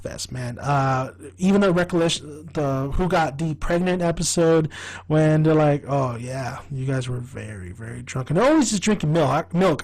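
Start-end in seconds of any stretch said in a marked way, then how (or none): 5.9-8.3 low, hushed imitation; quiet mock McPoyle voice. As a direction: murmur it low and creepy.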